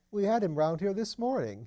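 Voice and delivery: a nervous, eager tenant chattering away; Mr Thipps. Acted.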